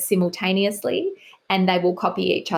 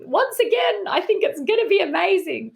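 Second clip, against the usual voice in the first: much higher, sugary mock praise; gushing editor sign-off; 11 semitones higher than usual. Editor closing a critical email to the author, sugary and much higher. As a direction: end the critique with a syrupy high compliment. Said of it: "they come in and they say".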